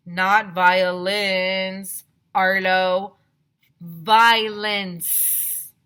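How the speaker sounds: loud, slow, exasperated correction; correcting slowly. A narrator's reading. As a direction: spell it out to a dim boy. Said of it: "asked Andrea".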